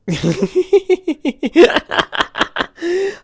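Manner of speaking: high-pitched giggle; loud squeaky laugh. Real, not acted. Laughs at stream mess.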